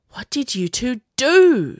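hissed. A narrator's reading. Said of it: "she hissed".

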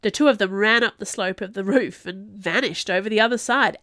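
ordinary delivery

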